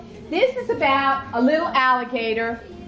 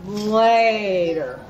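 lazy Later. Acted. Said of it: "And he'd say".

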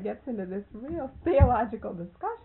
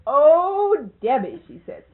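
high, loud commanding call; Miss Mary calling out; 6 semitones higher, 11 dB louder than usual. Retold or imitated. An old lady loudly sending Debbie to the bedroom.

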